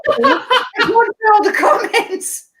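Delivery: giggling at the frozen computer and comments; laughing. Real, not acted. Laughing at co-host freezing on screen, talking through laughter.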